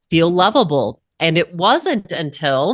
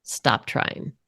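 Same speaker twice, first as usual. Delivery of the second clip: "Stop trying." breathed with no voice and much softer; whispered "Stop trying."; 6 semitones lower, 8 dB quieter than usual. Retold or imitated. Teacher whispers "Stop trying." to the woman on stage. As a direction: hush the words "Stop trying." right down to a whisper. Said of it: "He said".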